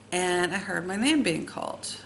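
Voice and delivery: ordinary talk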